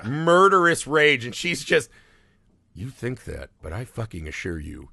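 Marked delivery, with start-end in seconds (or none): 2.7-4.9 seething mother. Retold or imitated. Mother snaps at clueless husband.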